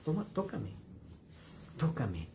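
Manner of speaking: lightly voiced Jesus, hushed; Jesus inviting Thomas. Jesus tells Thomas to touch him, softly. Jesus quoted offering his wounds.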